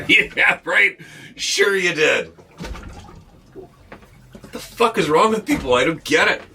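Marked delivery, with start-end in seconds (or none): 0.1-2.3 sarcastic disbelief. Retold or imitated. Mocking a friend's sighting, higher sarcastic scoff. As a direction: sneer it like a doubter brushing them off. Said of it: "Instead of".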